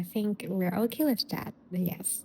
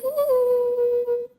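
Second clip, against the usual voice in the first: excited whoop; 7 dB louder than usual. Real, not acted. Hyping up the guest's introduction.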